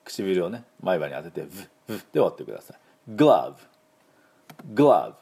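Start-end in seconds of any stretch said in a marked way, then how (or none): 3.1-5.1 グローブ slow, ending on a clear v; baseball glove word. Shown as a demonstration. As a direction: say グローブ slowly with a firm final v. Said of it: "この音です".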